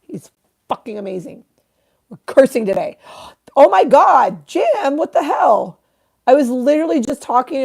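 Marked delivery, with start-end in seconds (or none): 3.6-7.6 loud, high-pitched surprise; real delighted shock. Real, not acted. Streamer spots a missing friend in chat, high loud surprise. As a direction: burst out high at an unexpected arrival.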